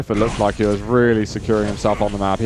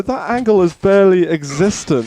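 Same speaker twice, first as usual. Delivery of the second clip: amazed; 7 semitones higher, 3 dB louder than usual. Real, not acted. Amazed at the rail shot.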